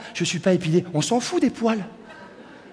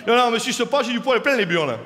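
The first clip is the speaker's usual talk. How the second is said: put-on southern French accent, sing-song; accent du Sud. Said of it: "avec l'accent du Sud".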